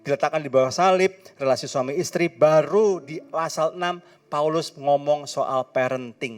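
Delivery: full-force preaching. Acted. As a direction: drive the point home to the congregation.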